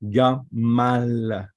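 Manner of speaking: "Gamal" with the M held long and strong; emphasised double M. Shown as a demonstration. Teacher showing a Dagesh in Mim, emphasised double M. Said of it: "So you put emphasis on him".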